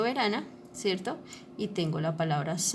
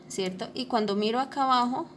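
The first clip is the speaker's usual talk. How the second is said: ordinary talk, nothing marked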